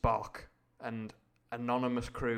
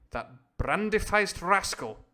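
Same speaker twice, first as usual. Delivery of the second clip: scornful sneer; 8 semitones higher, 7 dB louder, slower than usual. A narrator's reading. Narrator spits out insult.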